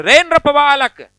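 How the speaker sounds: loud, slow angry outburst; angry Parashurama. Acted. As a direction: thunder it at the young prince. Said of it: "गुस्से में आ गए और क्रोध में आकर के क्या बोले".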